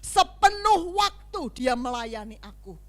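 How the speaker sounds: loud, high, emphatic declaration; forceful preaching. Real, not acted. Preacher explaining why the master buys a servant, forceful. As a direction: drive each phrase home to the congregation.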